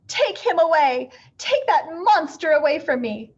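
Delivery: high, loud frightened shriek; terrified neighbour shrieking. A narrator's reading. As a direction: shriek at the monster in terror. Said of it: "Missus Hipple began to shriek".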